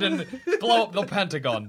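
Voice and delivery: ordinary talk, nothing marked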